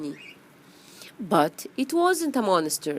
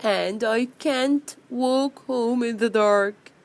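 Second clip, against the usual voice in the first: higher-pitched storybook wail; 4 semitones higher, 3 dB louder than usual. Acted. Bee afraid of walking home in the dark, wailing. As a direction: keep wailing like a helpless little bee. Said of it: "wailed the greedy bee".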